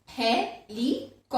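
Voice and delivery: "helicopter" with pauses as he, li, cop, ter; syllable by syllable. Shown as a demonstration. Teacher repeats a four syllable example, syllable by syllable. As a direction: sound out "helicopter" in four beats. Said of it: "Four syllables".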